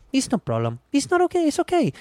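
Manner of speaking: high soothing mother tone; consoling mother. Retold or imitated. Maid consoling the guest like a soothing mother. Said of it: "Like a consoling mother".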